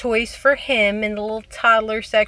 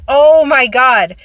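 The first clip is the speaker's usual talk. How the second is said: retold panic; 2 semitones higher, 8 dB louder, slower than usual. Terrified girl realizing stalker.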